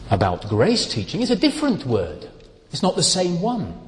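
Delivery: usual speaking voice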